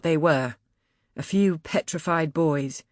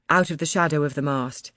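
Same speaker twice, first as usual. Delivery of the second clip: plain reading voice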